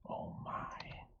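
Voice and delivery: plain reading voice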